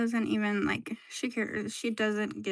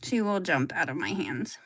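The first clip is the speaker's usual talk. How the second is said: normal talking voice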